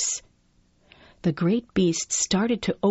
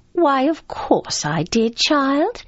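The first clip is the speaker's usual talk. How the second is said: slow, high-pitched grandmotherly reply; grandmother's voice; 6 semitones higher, 4 dB louder, slower than usual. Acted. Grandmother answering the child about Peter Pan, high-pitched. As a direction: lift it high and slow, rising on the last word. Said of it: "she also says".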